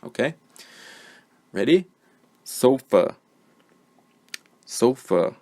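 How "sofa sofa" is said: "Sofa" said alone, louder first syllable, schwa ending; careful word model. Shown as a demonstration. Teacher saying the word so learners find the schwa, careful model. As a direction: dictate "Sofa" clearly as SO-fuh, then repeat. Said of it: "I'm going to say the word".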